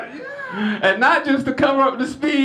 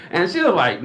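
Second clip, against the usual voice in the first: ordinary delivery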